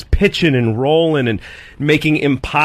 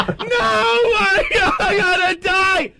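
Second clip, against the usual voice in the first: mock crying wail, much higher and slow; hammed-up terrified wail; imitating sobbing scream; slower than usual. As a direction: sob it out half laughing, like a panicked passenger. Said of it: "she's got tears bursting".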